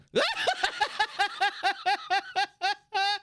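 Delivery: long high-pitched cackling laugh; cackle. Shown as a demonstration. Streamer showing the cackle asked about, high cackle. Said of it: "my cackle".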